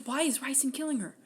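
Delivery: usual speaking voice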